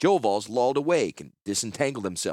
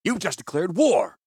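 high, raised-voice accusation; angry outburst; 8 semitones higher, 4 dB louder than usual. A narrator's reading. Commander drawing his sword on his subordinate, high angry outburst. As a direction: burst out at the culprit, pitch leaping.

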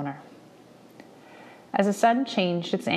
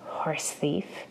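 menacing whisper; 6 dB quieter than usual. Acted. Indian Agent into the boy's ear, menacing whisper. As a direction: breathe it as a threat. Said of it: "whispered, his breath rank".

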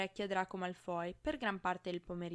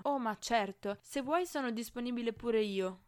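louder, mock-obliging offer; sarcastic; 3 semitones higher, 6 dB louder than usual. A narrator's reading. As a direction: lay on the sarcasm, a bit louder. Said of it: "sibilò sarcastico".

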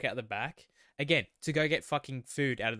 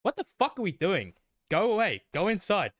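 louder, higher alarmed yelling at the crowd; panicked warning; 3 semitones higher, 6 dB louder than usual. Pretend. Pretend wedding guest telling the crowd to get inside, alarmed and loud. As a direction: urge everyone to get away now. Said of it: "I'd be sitting there like".

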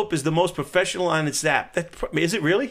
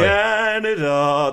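drawn out; 3 semitones higher, 4 dB louder, much slower than usual. Pretend. Drawn out Canadian greeting.

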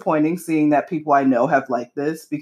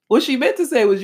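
usual speaking voice

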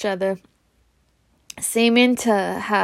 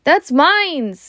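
protest; 7 semitones higher, 4 dB louder than usual. Acted. Harry protests grabbed letter.

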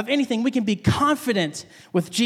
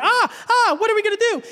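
high-pitched mock panic; acted panic, voice shooting up; freaking out; 11 semitones higher, faster than usual. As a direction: cry out like frightened fishermen in a storm. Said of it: "they're freaking out, scary fear".

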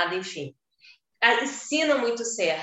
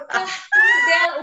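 laughing; 6 dB louder than usual. Real, not acted. Laughing at daughter's paulista word.

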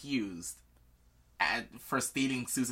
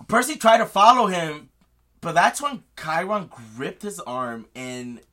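ordinary talk, nothing marked

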